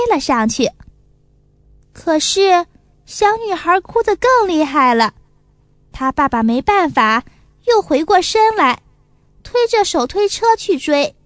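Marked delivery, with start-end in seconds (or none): none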